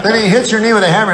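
usual speaking voice

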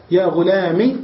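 "غلام" said "ghulami", short i; kasra without ya. Shown as a demonstration. Teacher repeating the three vowels, short i ending. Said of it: "بالحركات الثلاثة بدون ياء".

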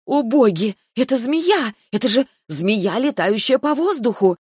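high, loud panicked exclamation; frightened cry. Acted. Heroine screams at flying snake.

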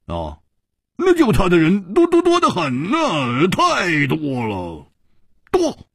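high, stretched-out insistence; desperate self-reassurance. A narrator's reading. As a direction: wail it out, dragging the words. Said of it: "拼命地在安慰自己".

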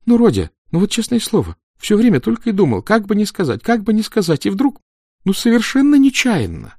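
put-on high, loud pleading boy's voice. A narrator's reading. Makeup boy begging the stage manager, high and pleading. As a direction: whine it out like a scared kid. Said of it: "забормотал".

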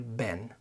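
"بان" as American "ben", short eh; light, quick vowel. Shown as a demonstration. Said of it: "خفيفة سريعة". (بان خفيفة سريعة)

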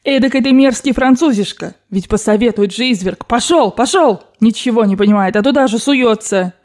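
put-on emphatic, indignant old lady; angry scolding. Grandmother berating the Frenchman after the loss, angry scolding. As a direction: berate him and drive him off. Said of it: "крикнула бабушка".

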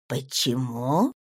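calm, measured noblewoman; cool baroness. A narrator's reading. Baroness voiced.

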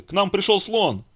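high-pitched, eager announcement; excited yell. A narrator's reading. Bear announcing the elephant's visit, excited yell. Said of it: "заорал Мишка".

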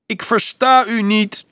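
very high, slow, drawn-out shout. A narrator's reading. Dolf to officer high above, shouting up. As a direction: holler slowly with hands cupped round the mouth. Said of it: "schreeuwde hij naar boven".

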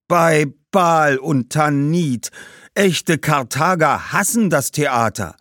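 indignant ghost. Acted. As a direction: exclaim it slowly in outrage. Ghost exclaims, appalled by theatre. (empörter, lauter Geist)

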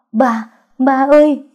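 loud, high, drawn-out anguished call; wailing cry. A narrator's reading. Daughter calls to her dying father, wailing. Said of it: "Tuệ Lâm gào khóc".

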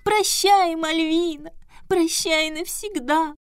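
high, slow tearful squeak; wailing boo hoo crying. Acted. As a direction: wail it like a heartbroken Pierrot. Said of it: "плаксивым голосом пропищал".

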